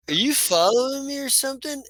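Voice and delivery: high-pitched, questioning goblin voice. Acted. Goblin turning on the person behind him, high suspicious question. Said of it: "And it's a goblin".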